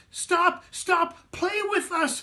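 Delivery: high, loud calling; children calling after the fleeing matzah man. Acted. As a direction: shout after him to stop. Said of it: "they called".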